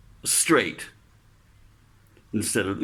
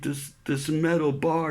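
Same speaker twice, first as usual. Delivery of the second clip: ordinary talk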